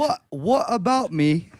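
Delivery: slow put-on British accent. American DJ on his last question, in a British accent. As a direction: start slowly in a mock British accent. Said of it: "in a British accent".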